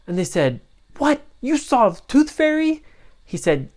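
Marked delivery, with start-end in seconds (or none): 1.0-2.8 very high surprised question; amazed. Acted. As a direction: gasp in disbelief. Family amazed.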